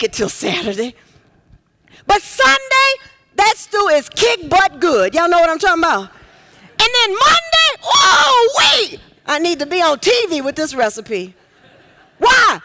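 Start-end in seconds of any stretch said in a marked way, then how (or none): 6.0-9.0 high, loud, drawn-out Monday; excited shout. Real, not acted. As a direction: build it up like the best news ever. Preacher building excitement about stew.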